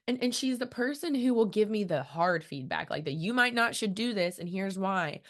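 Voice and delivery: ordinary delivery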